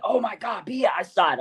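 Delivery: high, thrilled cry of recognition; retold excited outburst. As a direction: blurt it out like you just spotted the open man. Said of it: "I was like".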